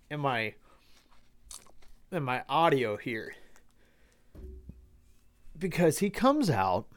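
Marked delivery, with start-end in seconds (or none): none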